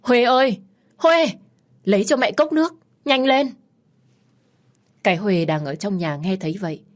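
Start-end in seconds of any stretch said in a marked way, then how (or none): 0.0-3.5 high, urgent shout into the house; loud calling. A narrator's reading. Daughter-in-law calling Huê for water, loud and urgent. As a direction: call out sharply for help. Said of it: "lớn tiếng gọi".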